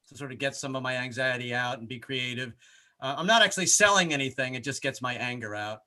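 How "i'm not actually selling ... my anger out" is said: ordinary delivery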